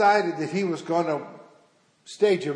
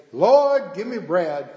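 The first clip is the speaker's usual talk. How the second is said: loud, higher opening cry on "Lord", then settling; preacher calling out; 3 semitones higher, 7 dB louder than usual. Acted. Preacher signals hidden boy from the pulpit, calling out. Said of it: "was preaching and preaching".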